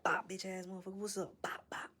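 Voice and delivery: high-pitched mock battle taunt; hammed-up fighter. Main character taunting the goblin as he fights, high mock battle cry. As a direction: play a cocky fighter throwing blows.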